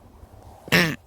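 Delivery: one loud sudden sneeze; sneezing. Real, not acted. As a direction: let a real sneeze burst out. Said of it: "Sorry, I'm gonna sneeze".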